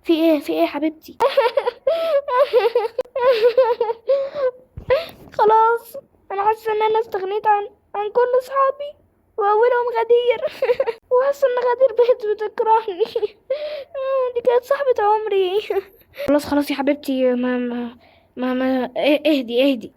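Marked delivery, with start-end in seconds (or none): 1.2-4.5 sobbing. Acted. Manar breaks down crying to him. 5.4-16.2 high, tearful voice breaking between sobs; crying. Acted. Manar sobbing to the classmate about losing her best friend, tearful and high.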